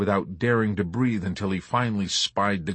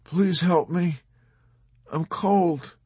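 high, plaintive bleat of agony; feeble whimper; 9 semitones higher, slower than usual. A narrator's reading. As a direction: whimper weakly, wounded and freezing. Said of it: "whimpered feebly ... in a bleat of plaintive agony".

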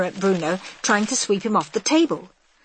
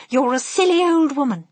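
high-pitched, affronted wife; cross insult; 8 semitones higher, 4 dB louder than usual. A narrator's reading. Missus Jenkins scolds grandmother, high and cross.

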